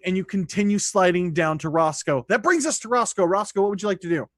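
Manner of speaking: usual speaking voice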